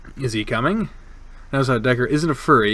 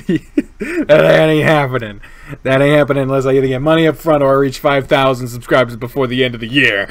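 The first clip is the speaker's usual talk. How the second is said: normal talking voice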